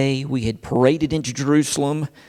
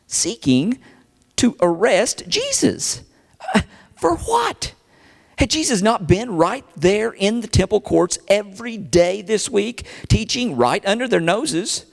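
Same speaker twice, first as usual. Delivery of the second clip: high-pitched, incredulous outrage; indignant questions pitched high; indignant disbelief; 7 semitones higher than usual. Acted. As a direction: protest in rising, scandalized disbelief. Indignant at Jesus's arrest.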